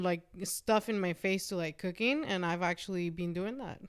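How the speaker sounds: ordinary talk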